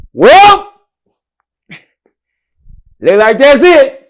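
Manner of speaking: slow, high, loud announcement; retold loud call. Announcing his release to the whole prison, loud and slow. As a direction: call it out across the silent block. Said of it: "You see how quiet it is".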